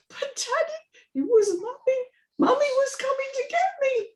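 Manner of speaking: put-on high child's voice, worried; a small girl frowning at her father; little girl. Amy's child voice.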